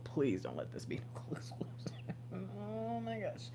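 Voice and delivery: soft whisper with a small laugh; whispered plea. Real, not acted. Reader pleading to viewers for a collective reading, whispered. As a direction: beg quietly, half-joking. Pleading softly over exclusive.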